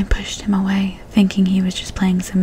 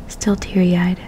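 plain reading voice

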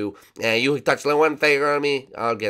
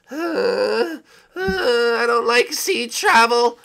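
nervous whine; 13 semitones higher, 7 dB louder, much slower than usual. Acted. Scared of sea travel.

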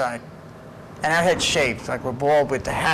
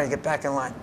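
ordinary talk, nothing marked

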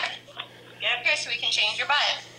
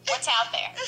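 ordinary talk

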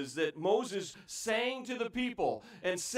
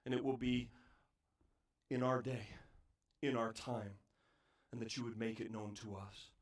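hushed prayer; 9 semitones lower, 9 dB quieter than usual. Acted. Preacher closing the prayer, hushed and low. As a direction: drop to a near-whisper before God.

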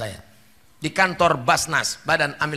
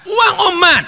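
high, loud cry of "uang umat"; forceful shout; 13 semitones higher, 8 dB louder than usual. Real, not acted. Preacher shouting at the congregation. Preacher stressing mosque money.

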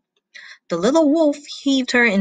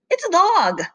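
high-pitched, exasperated correction; scolding; 10 semitones higher, 3 dB louder than usual. Acted. Mother wolf corrects her son, scolding. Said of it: "scolded his mother".

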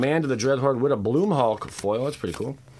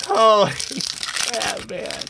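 higher, slow drawn-out holy crap; stunned awe; 9 semitones higher, much slower than usual. Real, not acted. Reacting to the foil, slow and stunned. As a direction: stretch it out in disbelief.

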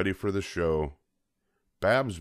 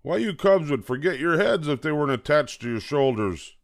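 mild scolding; 8 semitones higher, 5 dB louder than usual. Acted. Father scolding cubs.

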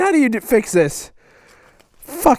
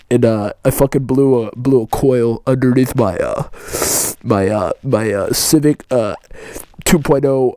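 vape bro; 6 semitones lower than usual. Acted. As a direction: ramble about cars and coils like a stoned vaper bro. Plays a vape bro character.

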